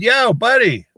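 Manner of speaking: high, loud friendly call across a field; bright call to a friend. Retold or imitated. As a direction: hail him from a distance. Calling out across the meadow.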